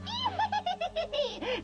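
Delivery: high wicked witch cackle; evil cackle. Acted. Witch reveals herself.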